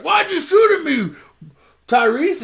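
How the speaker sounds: high, loud mock-indignant yell; Paul Walker voice. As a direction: squeak it out like a wounded action hero. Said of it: "So he's like".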